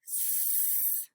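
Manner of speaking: a long unvoiced hiss on "S-"; teacher's "S-" as a breathy hiss; hissed s sound. Shown as a demonstration. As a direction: hold the hiss of "S-" with no voice. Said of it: "sound demo before the sentence".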